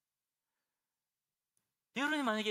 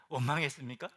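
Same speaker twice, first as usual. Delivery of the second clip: asks again through a laugh; laughing question; 3 semitones lower, 5 dB louder than usual. Real, not acted. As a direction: coax the silent crowd with a chuckle. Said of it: "반응이 없으시네".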